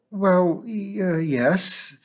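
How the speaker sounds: hesitant, trailing uncertain reply; vague. A narrator's reading. Missus Bonney dodging the teacher's remark, vague and halting. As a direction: stall vaguely, unsure what to say. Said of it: "said Missus Bonney vaguely".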